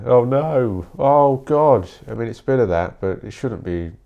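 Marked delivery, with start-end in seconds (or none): 0.1-1.9 mock panic. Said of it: "Life isn't fucking".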